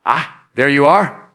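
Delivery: a slow, gentle, welcoming hello; gentle greeting. Shown as a demonstration. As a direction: greet it slowly like an old friend. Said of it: "Pause, say".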